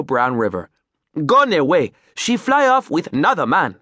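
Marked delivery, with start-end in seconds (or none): 1.2-3.8 louder, scornful Creole captain; bitter Creole accent. Acted. Captain scorning his runaway wife, Creole accent. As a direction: spit the words out in a Creole accent. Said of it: "spat venomously".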